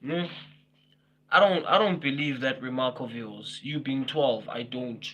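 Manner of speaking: ordinary delivery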